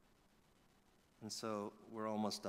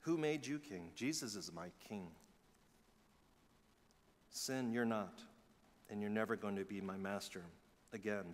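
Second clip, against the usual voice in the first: quiet, firm defiance falling softer; attitude towards sin; 4 semitones lower, 5 dB quieter than usual. Shown as a demonstration. Believer telling sin it is not master, defiant. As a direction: stare sin down and refuse it. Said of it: "Say that with an attitude towards sin".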